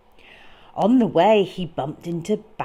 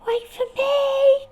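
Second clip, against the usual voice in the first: high, small squeaky call; tiny Dormouse cry; 7 dB louder than usual. Acted. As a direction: call out like a little mouse left behind. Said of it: "cried Dormouse".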